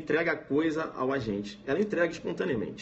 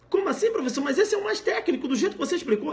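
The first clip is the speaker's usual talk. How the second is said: much higher, puzzled protest with a laugh; confused student; 13 semitones higher than usual. Acted. Student objecting to the examiner, much higher and puzzled. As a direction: protest in disbelief, half laughing.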